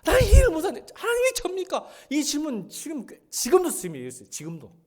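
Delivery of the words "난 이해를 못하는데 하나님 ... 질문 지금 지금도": very high, loud cry to God; overwhelmed crying out; impassioned outcry. Real, not acted. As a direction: plead at the top of the voice. Preaching at full force.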